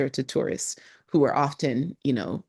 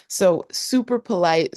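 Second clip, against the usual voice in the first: ordinary talk, nothing marked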